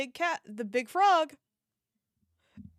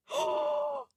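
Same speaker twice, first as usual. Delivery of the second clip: gasp. Real, not acted. Delighted gasp at toy.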